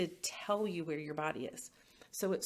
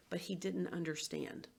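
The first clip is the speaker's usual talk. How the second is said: ordinary delivery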